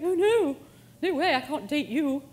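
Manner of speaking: high, loud mock refusal from a vegan; horrified vegan. Vegan turning down a meat-eating date, mock horrified. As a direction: recoil like an appalled vegan.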